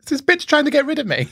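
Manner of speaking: high mock-suspicious voice; suspicious squeak. Son suspects mum wants rid of him, high squeaky voice. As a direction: squeak it out in disbelief. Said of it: "And I was like".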